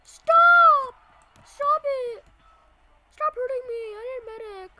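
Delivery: high, loud screams for help; panicked screaming. Real, not acted. Being hurt, begging for medic.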